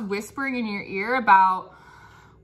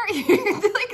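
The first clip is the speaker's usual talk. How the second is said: high laughing like; giggling; 9 semitones higher than usual. Real, not acted. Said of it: "it's really interesting".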